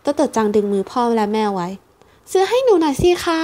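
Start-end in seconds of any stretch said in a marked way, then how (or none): 2.0-3.4 put-on high child voice begging, a little slow; small girl coaxing for a chick; pleading little girl. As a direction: tug at her parents with your voice. Child begging her parents.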